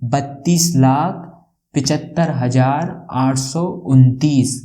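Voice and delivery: each number said slowly and deliberately; slow read-out. Shown as a demonstration. Teacher reading the number to students, slow and deliberate. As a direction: spell out the big number slowly for the class. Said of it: "कैसे पढ़ेंगे?".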